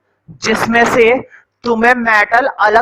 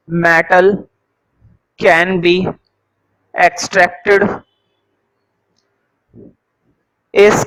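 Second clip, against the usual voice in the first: very slow, broken-up note dictation; 3 semitones lower, much slower than usual. Acted. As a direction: dictate a few words, then pause for writing. Dictates definition slowly to students. (बहुत धीरे, टुकड़ों में लिखवाते हुए)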